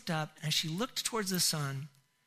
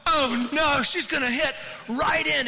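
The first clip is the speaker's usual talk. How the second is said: loud, raised cry of dread; retold alarm; 7 semitones higher, 7 dB louder, slower than usual. Dad running out with his little girl into a wave, alarmed. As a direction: call out in alarm as the wave comes.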